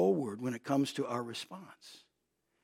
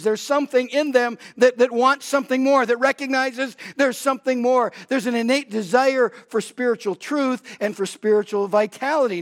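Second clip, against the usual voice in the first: louder, higher preaching drive; fervent build to rebellion lines; preaching at full force; 7 semitones higher, 7 dB louder than usual. Acted. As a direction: press the point home from the pulpit. Preaching on spiritual hunger.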